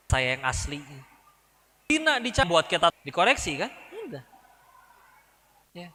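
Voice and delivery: ordinary talk, nothing marked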